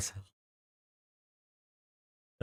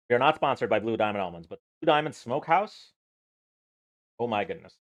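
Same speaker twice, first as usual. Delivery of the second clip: ordinary talk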